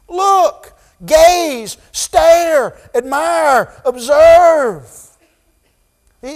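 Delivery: high, loud, fervent sermon climax; preaching at full force. Acted. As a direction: preach it out, urging them to look.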